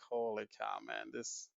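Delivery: quiet, deflated disbelief; retold dismay. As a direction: let it drop away under your breath. Retells dismay at watching game.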